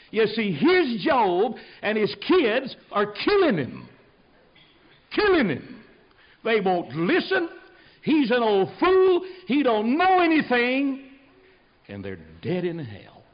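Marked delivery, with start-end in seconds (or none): 0.1-11.1 high, loud, slow preacher's cry; full-force preaching. Real, not acted. Preacher warning the congregation about Job's kids, impassioned shouting. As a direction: drive each phrase out slowly at a high shout.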